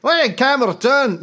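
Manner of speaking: put-on accent meant Irish, landing Scottish; slipping into Scottish. Host's mock Irish review reading slides into Scottish accent. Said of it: "going too Scottish".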